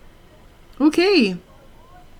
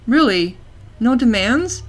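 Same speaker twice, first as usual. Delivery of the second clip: ordinary talk, nothing marked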